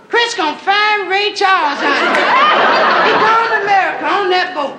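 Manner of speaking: high excited falsetto; camp high voice. Acted. Queens running through the castle, high falsetto. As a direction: squeal it down the halls.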